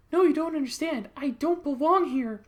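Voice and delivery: high, loud pleading character; Sarah. Acted. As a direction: protest in a put-on high voice. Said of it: "I'd have Sarah be like".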